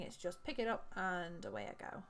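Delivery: ordinary delivery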